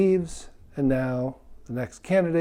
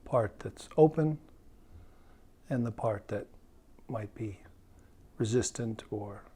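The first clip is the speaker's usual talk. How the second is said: guided meditation. Real, not acted. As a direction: guide them gently inward, leaving space between phrases.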